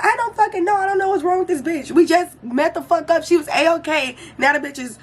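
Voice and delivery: high exasperated rant; to the friends asking about her, high irritated retort. Retold or imitated. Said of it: "I'm irritated".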